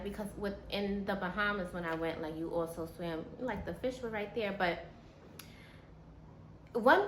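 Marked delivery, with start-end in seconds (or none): none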